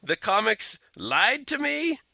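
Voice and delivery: high, outraged disbelief; mock shock. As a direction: act betrayed by the comics. Feigned shock at comics lying.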